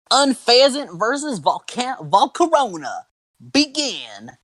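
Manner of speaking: high, drawn-out announcer call; hammy battle referee starting the match. Acted. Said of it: "I love this voice".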